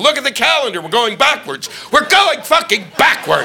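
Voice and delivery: high, shouted warning; screaming. Retold or imitated. Comic screaming at people to look at the calendar, high shout. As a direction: scream it at everyone passing. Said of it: "I kept screaming at people".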